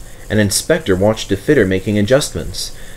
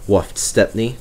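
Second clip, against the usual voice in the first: ordinary delivery, nothing marked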